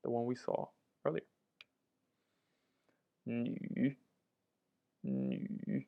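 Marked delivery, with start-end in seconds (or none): 3.2-5.8 "nu" soft and low in a dipping third tone. Shown as a demonstration. Teacher modeling Chinese for learners, quiet low dipping tone. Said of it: "also third tone".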